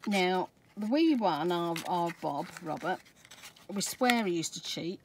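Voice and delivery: ordinary talk, nothing marked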